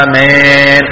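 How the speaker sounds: slow chanted آمين as "ameyn"; congregation's echoing ya. Retold or imitated. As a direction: mimic worshippers answering in the same tune, آمين with a long eh. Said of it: "بيعطوه على نفس النغم". (آمين ممالة على نغم الإمام)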